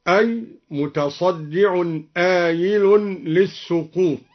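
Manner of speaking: normal talking voice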